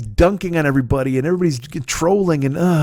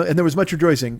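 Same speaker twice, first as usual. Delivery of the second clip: normal talking voice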